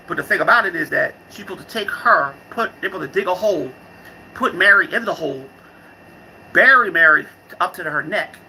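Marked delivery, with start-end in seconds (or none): none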